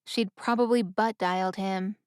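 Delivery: plain reading voice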